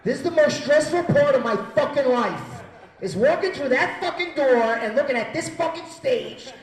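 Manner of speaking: high, loud outburst about the stage; stressed-out rant. Acted. Comic rants to the crowd about his most stressful moment, stressed-out shouting. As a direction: shout it in rising panic.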